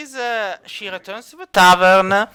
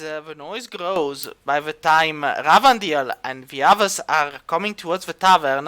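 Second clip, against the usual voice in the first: ordinary talk, nothing marked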